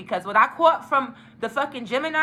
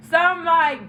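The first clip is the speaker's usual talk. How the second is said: ordinary talk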